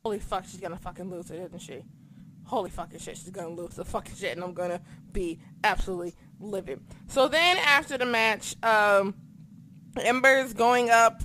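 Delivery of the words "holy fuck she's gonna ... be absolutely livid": low, fast, hushed fretting; low fast anxious murmur; hushed worry. Retold or imitated. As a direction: rattle it off under the breath, worried. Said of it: "mimicking her own panic".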